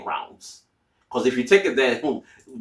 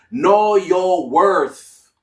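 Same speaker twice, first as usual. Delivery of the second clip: each word drawn out, louder and higher; slow emphatic declaration; 6 semitones higher, 6 dB louder, much slower than usual. Real, not acted. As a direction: hammer the headline point home slowly. Emphatic key advice point.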